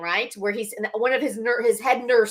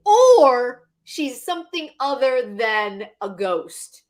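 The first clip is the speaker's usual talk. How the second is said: slow, heavy stress on "told you", "three options" and a long "or"; drawn-out "told", a loud, stretched "or" before the last option; exasperated reminder; 2 semitones higher, 4 dB louder, slower than usual. Retold or imitated. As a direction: spell out "our shaman told you" word by word. Exasperated viewer lecturing the character.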